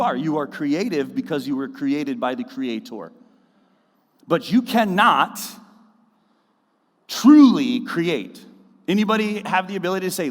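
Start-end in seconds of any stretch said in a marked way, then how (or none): 4.3-8.4 slow and louder, leaning on truly; emphatic preaching. Real, not acted. Pastor driving home his point to the church, slow and loud. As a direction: draw out truly create.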